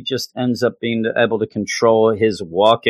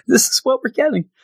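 giggly Aurora; 13 semitones higher, faster than usual. Acted. Aurora flustered, laughing.

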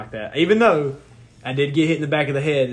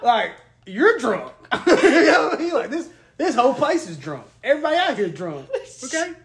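laughing; 11 semitones higher than usual. Real, not acted. Laughing, high, retelling confusion.

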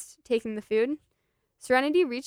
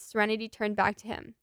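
ordinary delivery

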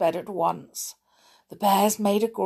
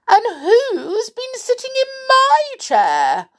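high, loud, indignant bear voice; Mother Bear's cry; 7 dB louder than usual. A narrator's reading. Mother Bear finding her chair sat in, crying out. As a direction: cry out far above your usual pitch. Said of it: "cried Mother Bear".

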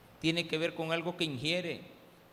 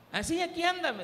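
mock caller; 11 semitones higher, 5 dB louder than usual. Church calls back about daughter.

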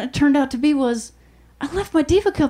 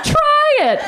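daring; 13 semitones higher than usual. Retold or imitated. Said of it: "I was like".

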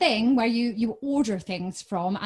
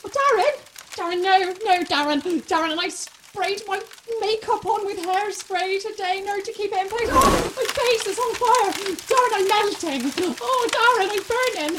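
high panicked wailing, much higher; burning panic; 8 semitones higher than usual. Acted. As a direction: scream as your face burns. Face on fire from hairspray.

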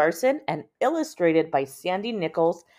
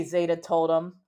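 ordinary delivery

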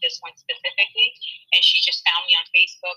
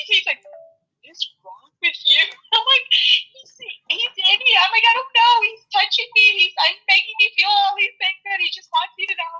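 high and laughing through the words; can't stop giggling while telling it; breathless, giggling retelling of the spirit's message; 3 dB louder than usual. Real, not acted.

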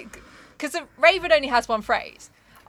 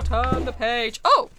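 ordinary talk, nothing marked

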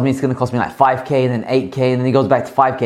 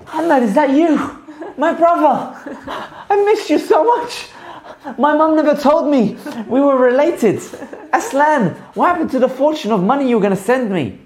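mock emotional reunion; 12 semitones higher than usual. Streamer greets supposed long-lost brother, hammed-up joy.

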